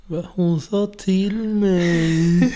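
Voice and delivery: put-on high, slow, wailing little-girl whine; imitating a crying child. Mom imitating crying daughter Audrey, a high, slow wailing whine. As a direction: mimic a sulky toddler dragging out every word through tears. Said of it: "Och så börjar hon gråta".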